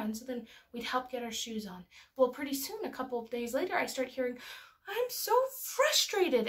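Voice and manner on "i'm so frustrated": high-pitched, imitated toddler exasperation, louder on the last word; frustrated small child. Mother imitating her daughter naming her emotion, frustrated child voice. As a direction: act out a little kid's big frustration.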